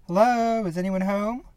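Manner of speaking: high, loud call at a door. Acted. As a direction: call out to see if anyone's home. Calling out at a farmhouse door.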